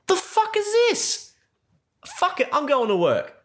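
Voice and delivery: very high, dismissive squeaky voice; a mock-baffled cartoon Chris. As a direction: scoff at it in a silly high voice. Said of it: "And it's like".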